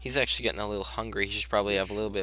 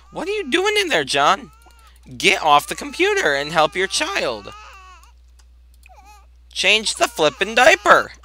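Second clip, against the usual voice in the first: loud, high, exasperated scolding; mock yelling; 10 semitones higher, 7 dB louder than usual. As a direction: yell at John to get off the computer. Yelling at sim John.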